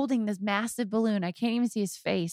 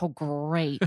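mock dismay; 7 semitones lower than usual. Daughter reacts to marrying accent.

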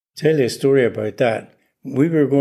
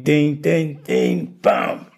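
spelled-out run of notes ending on a bam; sing-song dings, then land the bam; imitated flute notes; 4 semitones higher, much slower than usual. Shown as a demonstration. Flutist demonstrating the end of the concerto, imitated notes. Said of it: "I managed to do it like".

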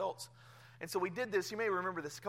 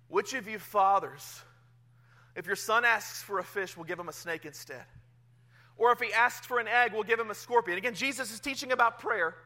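scripture reading; 4 semitones higher, 8 dB louder than usual. Acted. Reads scripture aloud.

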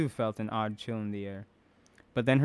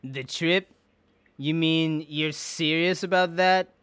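gruff suspicious man; 5 semitones higher, 6 dB louder, slower than usual. A narrator's reading. Gabe voiced, suspicious.

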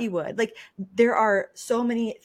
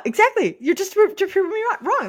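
excited; 13 semitones higher, 6 dB louder than usual. Real, not acted. Co-host proved her wrong.

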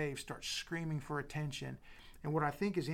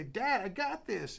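proud little son; 7 semitones higher, 4 dB louder than usual. Retold or imitated. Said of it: "he turned around to, like, say".

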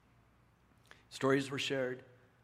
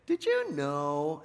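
condescending tease; 3 semitones higher, 5 dB louder, much slower than usual. Pretend. Mocking condescending question.

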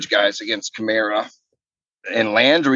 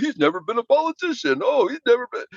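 mocking critics; 9 semitones higher, faster than usual.

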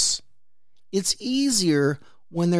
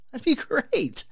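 eager thanks; 10 semitones higher than usual. Retold or imitated. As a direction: repeat it much higher, brightly relieved. Repeats thanks to neighbour, pitched up.

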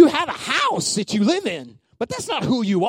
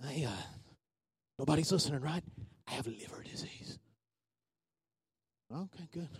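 hushed, secretive confession, much softer; mock whisper; 7 semitones lower, 19 dB quieter, faster than usual. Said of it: "I'm not gonna be like".

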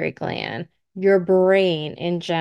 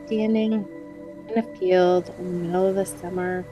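guided meditation; 7 dB quieter than usual. Acted. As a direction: gently guide them into calm relaxation.

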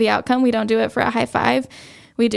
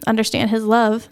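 normal talking voice

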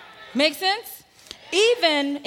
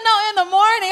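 higher, louder, firm no; firm loud correction; 8 semitones higher, 8 dB louder, faster than usual. Retold or imitated. Pastor correcting the preacher, raised and firm. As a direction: insist it is the morning.

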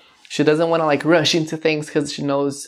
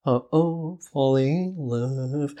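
muffled, soft, distorted vocal; lightly mimicking a distorted recording; 5 dB quieter, slower than usual. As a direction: imitate the warped vocal effect softly. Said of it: "this distorted voice".

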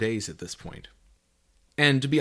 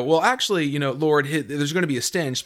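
higher, louder, mock know-it-all correction; clinical matter-of-fact; 5 semitones higher, 5 dB louder than usual. Martha correcting Lord, mock clinical know-it-all tone. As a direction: play it as a dry textbook remark. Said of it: "a sterile scientific statement".

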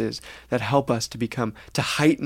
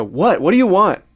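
loud, higher irritated retort; reactive snap; 7 semitones higher, 9 dB louder than usual. Retold or imitated. Snapping back at someone who just walked in. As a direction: bite back, annoyed at the interruption. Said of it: "I immediately respond and go".

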